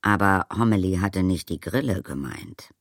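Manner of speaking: ordinary delivery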